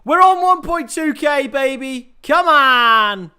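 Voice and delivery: loud, high celebratory shout; excited celebration. Real, not acted. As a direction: cheer the milestone at full voice. Celebrates reaching subscriber milestone.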